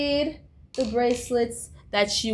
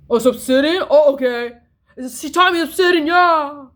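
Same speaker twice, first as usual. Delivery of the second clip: sarcastic mock; 6 semitones higher, 8 dB louder than usual. Mocking her friends' fake knowledge.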